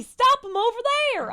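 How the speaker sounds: loud, high Southern guard yelling orders; Southern prison guard shout. Pretend. Guard chasing escaped convicts, hammed-up Southern shout. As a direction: holler like a Southern lawman on a manhunt. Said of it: "impersonation of prison guards with Southern accents".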